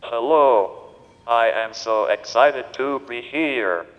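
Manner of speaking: synthetic text-to-speech voice; digitized voice. Real, not acted. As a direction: speak like a computer speech device. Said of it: "this digitized voice just does not seem to quite".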